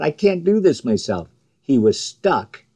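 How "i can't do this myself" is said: raised-pitch plea of helplessness; mild cry out. Acted. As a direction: cry out to God, without really shouting. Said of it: "Moses cried out".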